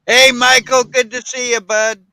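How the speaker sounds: loud, high, sing-song hello; booming sing song welcome. Acted. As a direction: boom out a cheery greeting. Greeting a friend arriving.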